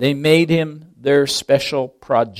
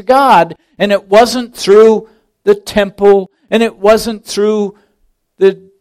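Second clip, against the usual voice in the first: loud, rising, insistent sermon build; preaching at full force; 5 semitones higher, 7 dB louder than usual. Acted. Preacher to congregation, building to a loud climax. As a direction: drive it home, louder each line.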